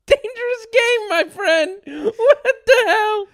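high, squeaky talking through laughter; wheezy high-pitched disbelief, laughing; real helpless laughing. Real, not acted. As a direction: squeeze the words out while cracking up. Cracking up at the retold prank.

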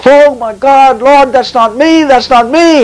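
high-pitched wailing protest to God; acted desperate plea.

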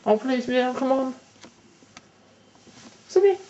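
high coaxing call; coaxing pet voice. Real, not acted. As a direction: coax the cat sweetly. Begging cat to meow.

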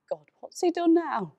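loud, high exasperated outburst. Acted. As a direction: groan about your wayward son, voice rising high. Mother dreads news about son.

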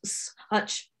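ordinary talk

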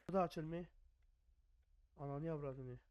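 ordinary talk

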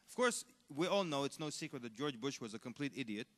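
ordinary talk

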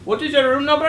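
imitating clerk. Retelling the pizza call, mocking the clerk's question. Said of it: "he's like".

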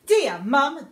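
usual speaking voice

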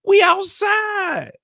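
high, loud, slow calling out; mock shouting over the wind; loud outside voice. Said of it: "use your outside voice".

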